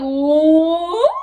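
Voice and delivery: loud, incredulous what; shocked what. Retold or imitated. Viewers reacting to season two, loud shock. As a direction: play the stunned viewer. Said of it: "you're gonna be like".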